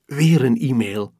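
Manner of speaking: plain reading voice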